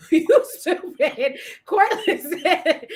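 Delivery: slow words broken by laughter; cracking up, voice riding high; laughing. Real, not acted. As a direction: talk through a real fit of laughter. Cracking up at co-host's remark.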